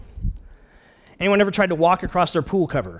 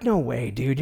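lower, scoffing imitation of doubting friends; mock dismissal; 5 semitones lower, 3 dB quieter, slower than usual. Friends doubt the buddy's video plan, lightly mocked dismissal. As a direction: brush the idea off lightly. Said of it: "It's ridiculous".

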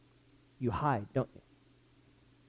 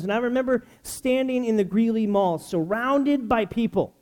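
ordinary talk, nothing marked

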